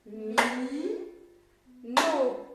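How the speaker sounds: MI, long pause, then loud NO; syllable by syllable. Shown as a demonstration. Teacher repeats the clapped name to be sure, syllable by syllable. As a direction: sound out "Mi. No." as two held beats. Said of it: "on refait une fois pour être bien sûr".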